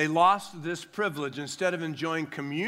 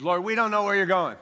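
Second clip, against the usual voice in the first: Thomas blurting it out, quick and loud; mock doubting Thomas; 4 semitones higher, 4 dB louder, faster than usual. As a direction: spout it out like an anxious doubter. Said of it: "I don't know if you said it like that".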